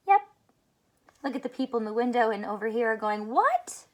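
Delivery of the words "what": high rising amazed what. A narrator's reading. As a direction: gape at the ducklings. Onlookers amazed by ducks.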